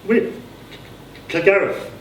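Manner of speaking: high, stammering, slurred surname; stammering announcement. A narrator's reading. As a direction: stumble over the name proudly. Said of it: "slurring the surname".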